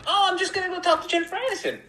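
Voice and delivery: very high, quick, squeaky self-parody; little squeaky voice. Acted. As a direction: squeak it out like a harmless pleader. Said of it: "me with my little squeaky voice".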